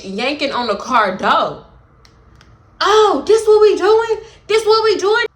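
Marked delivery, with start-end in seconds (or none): 2.8-5.2 high, loud mocking voice of the girl; mocking the angry girl. Retelling the girl at the car door, high and loud mock. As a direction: throw her taunt back high and loud.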